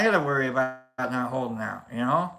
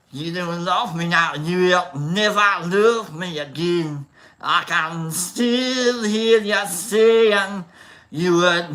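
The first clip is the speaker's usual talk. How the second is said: put-on slow, louder Scottish-accent singing; 4 dB louder, slower than usual. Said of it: "if I do Scottish".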